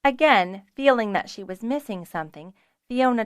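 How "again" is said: ordinary delivery, nothing marked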